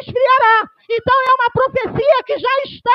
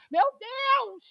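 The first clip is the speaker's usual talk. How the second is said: ordinary talk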